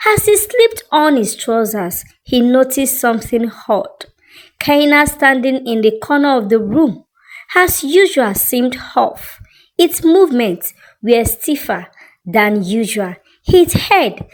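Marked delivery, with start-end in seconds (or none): none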